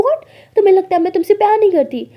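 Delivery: high, loud question; indignant disbelief. Acted. Alia hears her love doubted, loudly incredulous. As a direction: react as if stung by the accusation.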